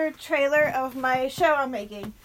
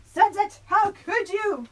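higher, drawn-out reproach; mock scolding; 7 semitones higher, slower than usual. Owner to the cat being weird, mock scolding. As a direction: scold a pet in mock dismay.

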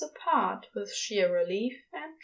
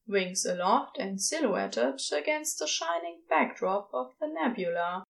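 ordinary delivery, nothing marked

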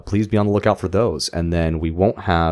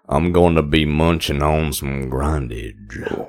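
slow, drawn-out slacker impression; Stoney; 3 semitones lower, slower than usual. Picturing the actor as Stoney, slow stoner impression. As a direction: drag the words out, dropping slightly in pitch. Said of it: "I wanna see him as Stoney".

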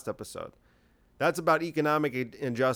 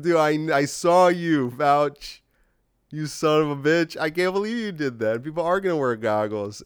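acted cracking up, higher and louder, easing off at the end; imitating hysterical laughing; 3 semitones higher, 4 dB louder than usual. Imagined friend teasing Fauci after the interview, mock hysterical laughing. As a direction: play someone barely getting words out for laughing. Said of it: "He's laughing hysterically and he's going".

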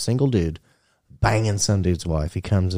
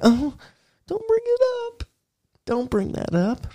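squeaky falsetto whine of protest; hammed-up falsetto plea; mock pleading. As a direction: beg in a very high put-on voice. Imagined cheaters begging him.

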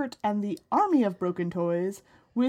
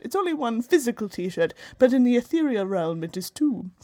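mock British accent. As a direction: put on a posh British voice for a donation plea. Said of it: "I'm gonna be British then".

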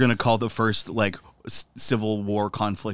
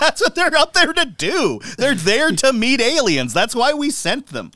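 higher, louder, slow mock outrage; indignant rant, higher and louder; 12 semitones higher, 5 dB louder than usual. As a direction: play it up like it's obvious to everyone. Mock outrage about astronauts.

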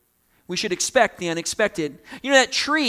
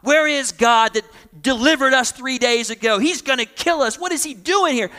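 higher, louder panicked whine; panicked complaining; 6 semitones higher, 6 dB louder than usual. Retold or imitated. Moses voicing a frightened grumbling crowd, high and loud. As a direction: complain in a rising, frantic voice. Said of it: "for Moses to be like".

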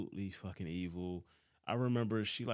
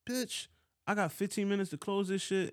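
high and loud indignant rant; exasperated outburst; 11 semitones higher, 4 dB louder than usual. Retold or imitated. Worker told at the last minute to close, indignant. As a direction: fume at the late notice. Retold outrage at late call.

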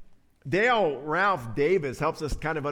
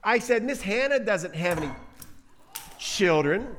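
put-on higher, louder child voice repeating itself; little child; 6 semitones higher, 5 dB louder than usual. Little one repeating to mommy, child voice. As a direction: pipe up like an insistent little kid. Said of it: "says the little one".

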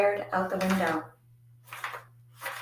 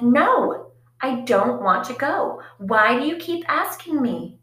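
irritated refusal; 5 semitones higher, 6 dB louder than usual. Retold or imitated. Refusing mother's tenth request.

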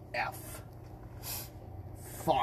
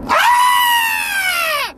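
piercing high shriek; shrill playful scream; 11 dB louder than usual. Acted. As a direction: scream like you saw a spider. Kid screams at pretend spider.

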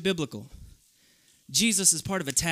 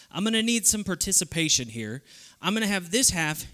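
ordinary talk

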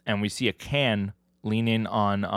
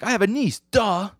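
much higher, mocking comeback; sassy retort; 12 semitones higher, 5 dB louder than usual. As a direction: toss it back with a loud "duh". Scornful comeback about kids.

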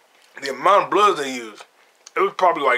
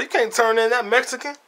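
higher, lightly voiced Warren; imitating Warren; 7 semitones higher than usual. Retelling Major Warren refusing the deal, higher mock voice. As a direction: toss off Warren's objection. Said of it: "Major Warren was like".

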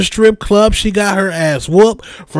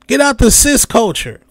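ordinary talk, nothing marked

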